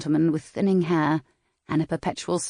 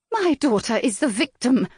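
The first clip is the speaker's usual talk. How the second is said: higher, indignant outcry; crying out; 8 semitones higher than usual. A narrator's reading. Mother cries out to the Earl, indignant and high. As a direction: protest it sharply up high. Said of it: "Lady Brightwell cried".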